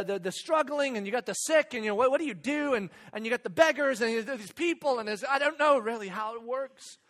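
ordinary talk

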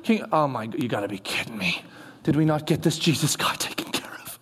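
aghast hushed whisper; shocked whisper. Retold or imitated. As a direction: hiss it under your breath in dismay. Herod reacting in his office.

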